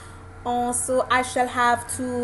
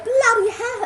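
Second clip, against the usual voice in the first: high, louder posh British exclamation; mock posh British accent; 10 semitones higher, 5 dB louder than usual. Shown as a demonstration. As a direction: swear in an affected posh English accent. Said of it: "doesn't work with that kind of accent".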